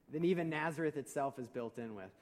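ordinary talk

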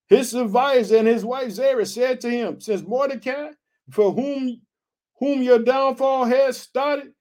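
high, measured Bible reading; scripture reading. A narrator's reading. Reads scripture aloud.

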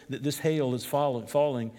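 usual speaking voice

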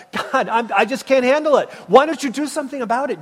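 loud, high pleading to God; anguished plea. Retold or imitated. Habakkuk pleading with God, loud anguished plea. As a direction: beg God for an answer, loud and fast at the end.

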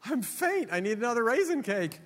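very high, mock-faint swoon; swooning woman. Preacher playing the bride flattered by compliments, hammed-up swoon. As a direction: gasp it out high, overcome by flattery. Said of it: "I mean, she's like".

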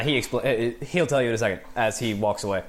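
usual speaking voice

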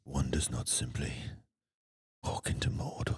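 lightly voiced Boromir, quiet and grave; hushed solemn movie line; Sean Bean impression. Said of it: "That's my Sean Bean".

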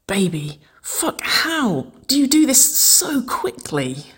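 high, breathy, slow groan; aroused, whispery moan of a question. A narrator's reading. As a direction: groan it half-whispered, overcome with desire. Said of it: "Waverley groaned".

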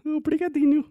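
high, loud mock Portuguese accent from Portugal; European Portuguese accent. Husband teasing wife in a hammed-up Portuguese accent. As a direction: ham up a Lisbon accent, way up high.

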